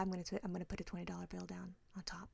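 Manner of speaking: quick whispered threat; fast whisper. Retold or imitated. Wife whispering a tip threat to her husband.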